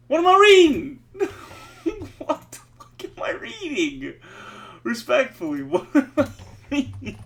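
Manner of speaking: incredulous laughter at the screen; laughing in disbelief. Real, not acted. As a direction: laugh through it, can't believe what you're reading. Baffled by the text, laughing.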